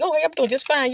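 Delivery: high, bright friendly answer; cheery reply. Retold or imitated. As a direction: chirp it back, lightly mock-friendly. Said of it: "You're like".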